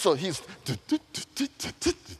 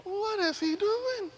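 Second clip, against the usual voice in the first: much higher, slow, puzzled servant voice; 3 dB quieter than usual. Retold or imitated. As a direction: ask it like a baffled onlooker. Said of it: "they're going".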